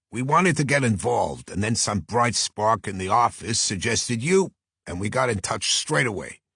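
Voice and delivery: insistent Brooklyn agent; Brooklyn accent. Acted. Agent urging detective to act quickly, insistent Brooklyn accent. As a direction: talk him into it, Brooklyn style. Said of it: "a voice with a strong Brooklyn accent".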